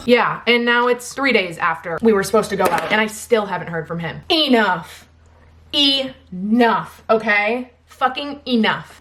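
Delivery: loud, emphatic venting; real fed-up yelling. Real, not acted. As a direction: raise your voice, really fed up. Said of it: "sorry I'm, like, yelling".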